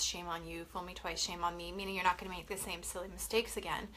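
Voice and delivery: ordinary delivery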